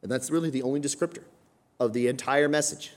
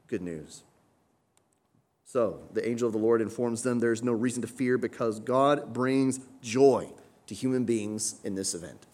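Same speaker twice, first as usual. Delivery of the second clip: usual speaking voice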